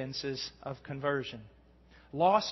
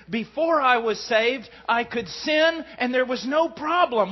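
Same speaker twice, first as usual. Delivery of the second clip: louder, higher preaching voice pressing the point; fervent preaching; 6 semitones higher, 5 dB louder than usual. Acted. Preacher pressing the new creature point to the congregation, fervent preaching. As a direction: drive the truth home to the congregation.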